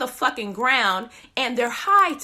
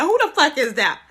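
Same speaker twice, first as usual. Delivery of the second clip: startled yell; 2 semitones higher, 6 dB louder than usual. Retold or imitated. Startled by noise in bushes.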